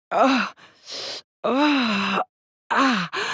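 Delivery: high, loud cries of pain. Acted. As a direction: cry out as if struck.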